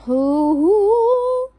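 loud hoot climbing to a very high squeal; nervous squeal. Real, not acted. Nervous over the book placement, rising squeal. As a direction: whoop upward, long and loud. Nervous noise while placing sticker.

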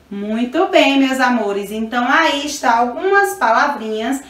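ordinary talk

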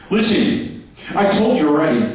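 ordinary talk

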